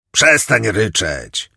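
put-on loud, slow, harsh shout at a crying boy; piercing yell. A narrator's reading. Convict yelling at the crying boy, loud slow shout. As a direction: bellow it to stop the sobbing. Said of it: "wrzasnął ktoś przeraźliwym głosem".

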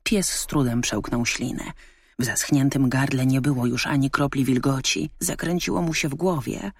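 ordinary delivery

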